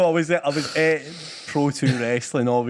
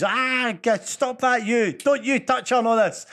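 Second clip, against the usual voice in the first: higher childish heckling, mock yell; imitating a shouting kid; 7 semitones higher, slower than usual. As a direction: heckle like a little girl at the wrestling. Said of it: "she's shouting at all the heel wrestlers".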